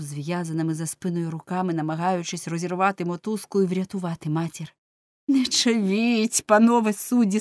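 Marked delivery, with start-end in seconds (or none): 5.3-7.4 crying out. A narrator's reading. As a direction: plead to the judges at full voice. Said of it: "скрикнув він". (гучний, високий, повільний крик-благання)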